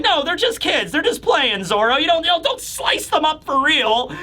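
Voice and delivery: high-pitched, anxious-comic plea; pleading protest. Acted. Usopp telling Zoro they're just kids, pleading protest. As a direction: talk him down, pitched well up.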